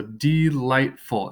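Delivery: delightful split into de-light-ful, drawn out; delightful broken into three slow, separate beats; syllable by syllable. Shown as a demonstration. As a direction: say DE-LIGHT-FUL slowly, one beat per syllable. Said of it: "clap out the sounds".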